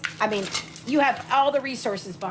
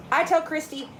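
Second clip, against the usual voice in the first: ordinary delivery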